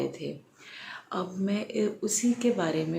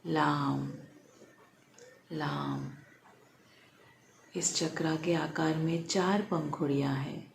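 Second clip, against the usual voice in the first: guided meditation. Real, not acted. Leads a guided chakra meditation.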